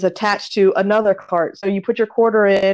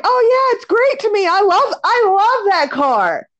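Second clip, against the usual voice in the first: retold gushing; 10 semitones higher than usual. Shopper gushing to the lady about her car, retold enthusiasm. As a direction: imitate yourself raving about it, pitched way up.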